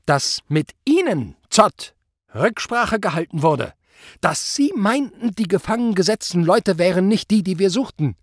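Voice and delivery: high, measured, accusing; barely controlled anger. A narrator's reading. Superior reads the report at Zott, barely containing anger. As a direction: keep the fury on a tight rein. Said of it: "seinen Zorn nur schwer unter Kontrolle".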